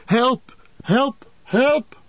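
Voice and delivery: high, desperate calls for help; shrill cry. A narrator's reading. Frodo running along the path, crying shrilly for help. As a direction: call out shrilly, each cry separate. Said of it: "shrill voice".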